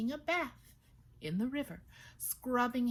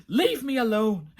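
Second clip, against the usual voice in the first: talking branch voice; 3 semitones higher, 12 dB louder than usual. A narrator's reading.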